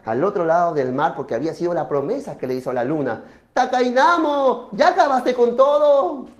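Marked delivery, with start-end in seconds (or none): 3.5-6.3 drawn-out, raised call; moon calling. Acted. Moon asks Takainamo if he is finished, raised calling voice. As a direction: call across a distance, then question.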